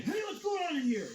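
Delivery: imitating the guard's barging-in yell; mock yell. Barging into the room at night, imitated mock yell. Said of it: "and yelled".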